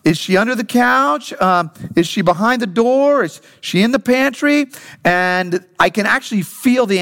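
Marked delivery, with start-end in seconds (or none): 0.1-4.7 playful, higher-pitched mock wondering; pretend puzzlement. Dad pretending he can't find his hiding daughter, playfully puzzled. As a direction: make a show of searching, questions lifting high. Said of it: "go through the motion of going like".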